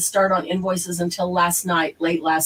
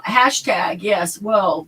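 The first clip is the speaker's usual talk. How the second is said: ordinary talk, nothing marked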